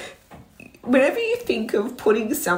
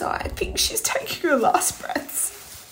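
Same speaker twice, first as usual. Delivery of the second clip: whispering; 8 semitones higher, 6 dB quieter than usual. Retold or imitated. Husband's words whispered.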